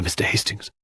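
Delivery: breathy unvoiced whisper on the name; leaning in, whispering the name. A narrator's reading. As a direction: drop to a secret whisper. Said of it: "sank her voice to a whisper".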